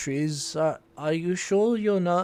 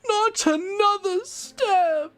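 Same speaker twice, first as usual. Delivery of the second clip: high, loud, slow shriek; shrieking ghost warning; 4 dB louder, slower than usual. Acted.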